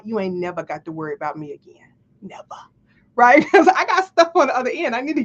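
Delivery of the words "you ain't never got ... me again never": low, soft mock menace; a quiet, deadly serious warning, played for laughs; mock threat. Threat with hand on neck.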